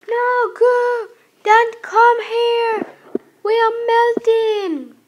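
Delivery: high, loud panicked yelling; panicked shout. Acted. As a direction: scream it out as they melt. Ice cubes panic while melting.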